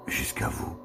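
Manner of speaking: ordinary delivery, nothing marked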